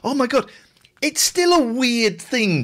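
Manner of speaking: remembering discovering the luggage stand, amazed; amazed realisation. Retold or imitated. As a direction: gasp at the discovery.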